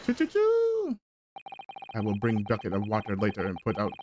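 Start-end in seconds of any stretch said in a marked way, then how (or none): 0.1-1.0 very high squeaky chirps; mouse squeak. Acted. Squeaking like a mouse to distract the crewman. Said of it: "That sounds like a big mouse in trap".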